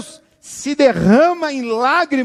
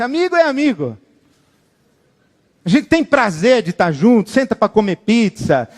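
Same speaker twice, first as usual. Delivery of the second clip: ordinary delivery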